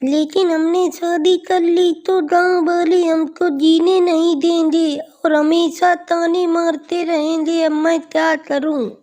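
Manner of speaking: put-on worried young woman. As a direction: fret aloud as the village girl.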